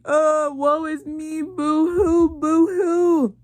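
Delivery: slow, hammed-up fake crying, pitched high; sarcastic boo-hoo whine; mock wailing. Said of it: "You can hear them like".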